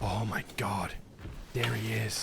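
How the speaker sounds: soft, low terrified murmur; hushed fear at spotting him; hushed alarm. Real, not acted. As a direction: breathe it out as you spot him. Spots monster, hushed.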